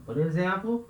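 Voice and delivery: normal talking voice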